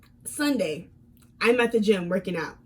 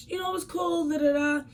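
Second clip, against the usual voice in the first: airy, higher, softer and easy, hiding what she knows; fake calm; 6 semitones higher, 3 dB quieter than usual. To her boyfriend, pretending nothing is wrong, calm. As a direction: sound breezy and unbothered on purpose. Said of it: "So calmly".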